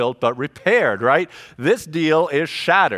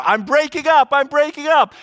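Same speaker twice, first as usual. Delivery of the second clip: much higher and louder, urgent astronaut cry; astronaut in distress; 12 semitones higher, 6 dB louder than usual. Retold or imitated. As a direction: cry it out like a pilot losing pressure. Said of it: "he tells control tower".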